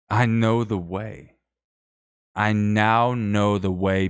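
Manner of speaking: loud, slow, drawn-out declaration; mock proclamation. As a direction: proclaim it like a grand revelation. Mock revelation about ending stream.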